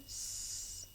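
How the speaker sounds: S said as a soft unvoiced hiss; whispered letter sound. Shown as a demonstration. Teacher modelling the S sound for young students, soft hiss. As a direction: breathe out a quiet s sound for the class. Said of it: "Your turn".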